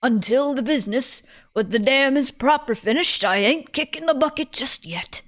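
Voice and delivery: put-on feisty British old lady; stubborn old woman with a British lilt; old person, British. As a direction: voice a plucky British granny. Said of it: "all my old people voices turn British".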